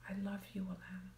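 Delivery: soft, tender whisper to yourself; loving whisper. Shown as a demonstration. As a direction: whisper it like you mean it. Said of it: "I'm going to say".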